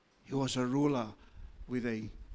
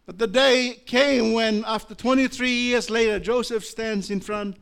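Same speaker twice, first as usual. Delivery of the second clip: rising preaching; 9 semitones higher, 10 dB louder than usual. Acted. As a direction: lift the congregation into the turning point. Climactic preaching build.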